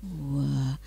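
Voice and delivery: usual speaking voice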